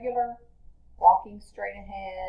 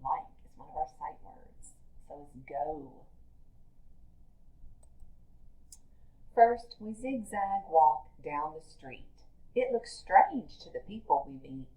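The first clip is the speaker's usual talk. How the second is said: lively read-aloud for a class; reading to children; 5 dB quieter than usual. Acted. Teacher reading a picture book to her class, lively read-aloud.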